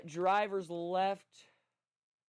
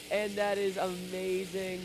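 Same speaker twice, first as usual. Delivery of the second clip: ordinary delivery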